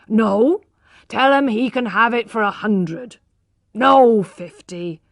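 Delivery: loud, eager haggling, price dropping each time; loud, excited haggling over a price; agitated bargaining. A narrator's reading. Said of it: "cried Lady d'Urberfield".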